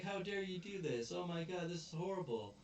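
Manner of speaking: high-pitched, appalled protest; high, hammed-up indignation; mock outrage. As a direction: act out a horrified complaint. Acted outrage at teacher.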